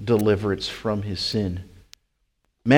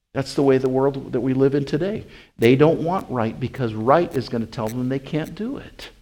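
ordinary talk, nothing marked

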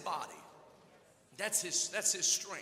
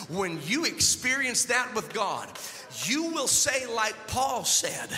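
loud, higher, shouted preaching climax; roaring sermon crescendo over the music; preaching at full force; 5 semitones higher, 7 dB louder than usual. Acted. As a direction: build and hammer each line to the congregation.

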